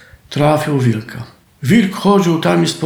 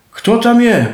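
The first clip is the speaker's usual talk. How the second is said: higher, louder call into the dark; shouted; 7 semitones higher, 4 dB louder than usual. A narrator's reading. Farmer shouting from upstairs at the noise, loud and high. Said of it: "krzyknął gbur".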